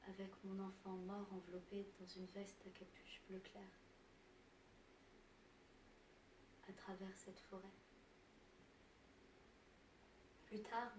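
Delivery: very soft, hushed narration; subdued grief. Acted. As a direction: barely voice it, with long pauses. Recounts carrying her dead child. (tout bas, douleur contenue)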